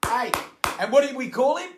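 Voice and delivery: ordinary talk, nothing marked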